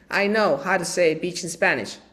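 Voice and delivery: normal talking voice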